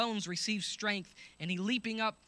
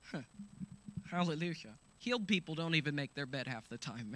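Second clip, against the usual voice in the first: ordinary talk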